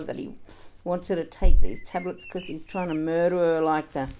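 usual speaking voice

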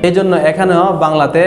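ordinary talk

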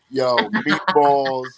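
a drawn-out, slow taunting "yo, meatballs"; mock taunt. Actor taunting Italian castmates, slow mocking drawl. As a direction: stretch it out to needle them.

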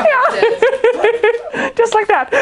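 high, giggly laughing words. Real, not acted. Speaker agreeing with a listener's "sounds easy", laughing. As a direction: talk through a fit of high giggles.

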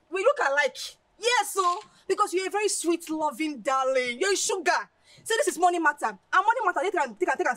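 high, loud effusive flattery; gushing excitement. Acted. Friend buttering Olivia up over money, gushing. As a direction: pile on sweet talk with delight.

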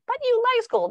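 high-pitched pleading rebuttal; parent contradicting child. Acted. Parent denying child's dislike of school, high insistent rebuttal.

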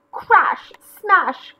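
high, loud impact words; crashing wipeout. Acted. McGreely wiping out in the surf, crashing. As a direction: hit each word explosively.

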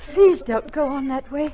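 desperate pleading. Acted. Nurse begging the delirious captain, anguished and loud. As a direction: beg him to stop.

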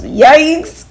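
a startled little yelp; comic yikes. A narrator's reading. Reacting to underclothes.

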